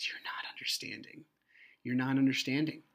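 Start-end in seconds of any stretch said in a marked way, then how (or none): 0.1-1.2 very soft, low, near-whisper; hushed protest. Retold or imitated. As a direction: say it almost under your breath. Retold plea to officer, hushed take.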